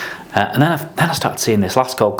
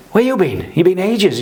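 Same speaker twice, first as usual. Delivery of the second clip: higher and louder, impatient telling-off; scolding mentor; 10 semitones higher, 4 dB louder than usual. Retold or imitated. Mentor to late apprentice, higher impatient scolding. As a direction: demand where the kid has been.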